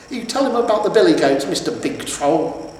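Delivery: high, loud taunt slowing at the end; mocking troll wife. A narrator's reading. Troll wife taunting her husband before the hero. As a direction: taunt Mister Big Troll.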